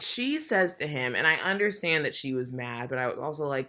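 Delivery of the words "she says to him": ordinary talk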